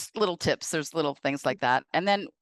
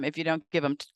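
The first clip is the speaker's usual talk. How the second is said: ordinary delivery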